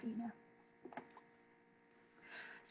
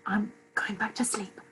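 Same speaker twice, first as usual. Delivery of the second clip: soft, whispery sign-off; acted drowsy half-whisper; sleepy murmur; 7 dB quieter than usual. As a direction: mumble it softly, settling back to sleep. Acting sleepy partner giving up.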